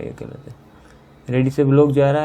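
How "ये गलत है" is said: whisper. Real, not acted. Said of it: "verdict said under the breath".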